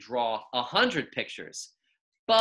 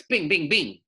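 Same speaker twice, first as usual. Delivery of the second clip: rapid-fire; 4 semitones higher, 4 dB louder than usual. A narrator's reading. Rapid drawing sounds.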